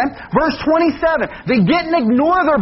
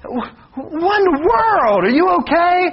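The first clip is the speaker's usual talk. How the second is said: alarmed; 6 semitones higher than usual. Retold or imitated. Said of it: "And Donna's like".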